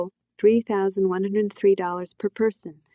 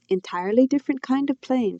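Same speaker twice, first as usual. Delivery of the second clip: mother's lightly voiced explaining tone, pitched up; explanation voice; 6 semitones higher than usual. A narrator's reading. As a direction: explain it with a higher lilt. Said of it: "in her English explanation voice".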